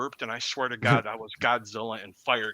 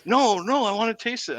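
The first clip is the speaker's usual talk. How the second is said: higher, insistent begging; pleading friend; 9 semitones higher than usual. Retold or imitated. Friend begging to taste the chili, higher and insistent. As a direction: whine to get a taste. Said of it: "I'm like".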